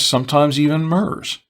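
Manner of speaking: ordinary talk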